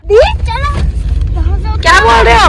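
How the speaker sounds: loud and high, calling out; to someone hard of hearing. Real, not acted. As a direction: raise your voice so grandma hears. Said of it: "दादी को सुनाई नहीं दे रहा". (ऊँची सुनने वाली दादी से ज़ोर से)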